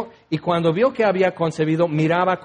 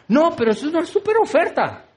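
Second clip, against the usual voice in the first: higher, gushing super oferta; mock excited shopper; 7 semitones higher, faster than usual. Shopper defending three irons, mock excited. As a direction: convince yourself it's a bargain.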